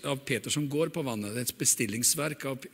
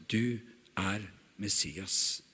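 soft, slow, near-whispered confession; reverent hush; 9 semitones lower, 8 dB quieter, much slower than usual. Acted. Peter confessing to Jesus, soft and slow. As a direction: confess it in hushed awe. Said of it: "Da svarte Simon Peter og sa".

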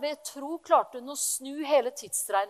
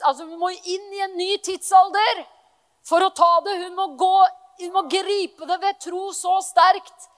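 louder, rousing preacher voice; fervent preaching; 4 semitones higher, 9 dB louder than usual. Acted. Pastor stirring the congregation's faith: fervent preaching. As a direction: preach it with rising fire. Preacher builds to full force.

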